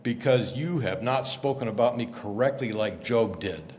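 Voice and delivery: low, ominous voice of judgement; angry God as deep movie villain; Darth Vader voice. Acted. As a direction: pronounce a deep, measured rebuke. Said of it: "I picture something like Darth Vader voice".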